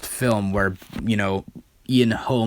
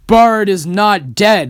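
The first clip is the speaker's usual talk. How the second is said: proud declaration; 10 semitones higher, 9 dB louder, slower than usual. Retold or imitated. Bard announces he survived.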